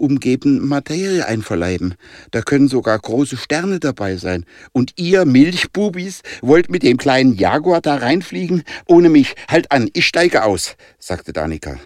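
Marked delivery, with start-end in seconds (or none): 4.7-10.8 raised, higher-pitched protest; indignant refusal. A narrator's reading. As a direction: scold the boys and demand out, pitch up. Girl refuses to fly in.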